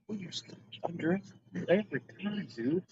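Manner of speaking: whispering. Real, not acted. Unvoiced aside.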